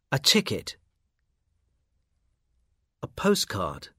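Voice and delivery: normal talking voice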